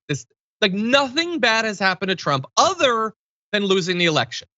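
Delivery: ordinary delivery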